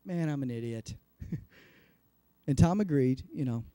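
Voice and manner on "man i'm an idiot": rueful laugh. Retold or imitated. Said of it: "I started laughing".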